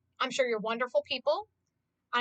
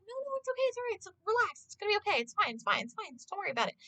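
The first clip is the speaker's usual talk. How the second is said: calm reassurance; 6 semitones higher, 7 dB quieter, much faster than usual. Retold or imitated. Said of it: "I'm like".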